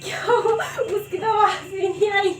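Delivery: high, laughing disbelief; real surprise, high and laughing; surprised laugh. Real, not acted. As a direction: squeal in amused surprise at the dud. Firecracker makes no sound.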